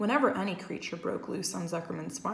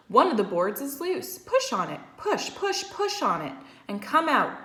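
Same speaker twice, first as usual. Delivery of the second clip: quick, high goose voice; lively farmyard goose; chattering goose; 5 semitones higher, 5 dB louder than usual. A narrator's reading. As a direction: voice the fussy goose. Goose voice in the story.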